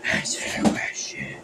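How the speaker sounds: demanding spooky whisper. Acted. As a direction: breathe the order out menacingly. Kid spooky questioning game.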